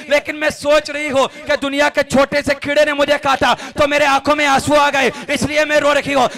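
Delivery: loud, impassioned sermon voice; preaching at full force. Acted. Imam telling the congregation a moving story, loud impassioned preaching. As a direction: pour out the story with rising fervour. Emotional climax of mother-child story.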